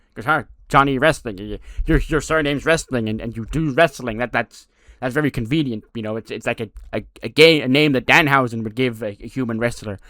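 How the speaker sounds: a lightly voiced quirky character; Danhausen voice. Danhausen mocking Johnny's name, lightly voiced. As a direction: stammer playfully as Danhausen.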